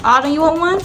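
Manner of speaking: sweet question to a pet. Real, not acted. Asking dog in baby talk.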